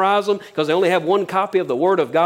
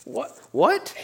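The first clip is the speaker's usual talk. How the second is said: a sharp, much higher astonished "What?"; mock shock; 11 semitones higher, 3 dB quieter than usual. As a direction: act stunned by the paradox, pitch leaping up. Preacher feigns shock at paradox.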